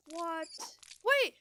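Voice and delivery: high, loud startled yelp; surprised shout. Real, not acted. Player startled as the cat arrives, high surprised shout. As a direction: jolt up in surprise.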